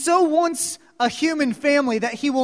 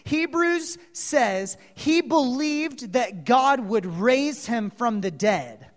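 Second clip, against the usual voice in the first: normal talking voice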